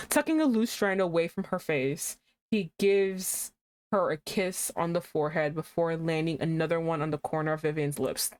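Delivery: ordinary delivery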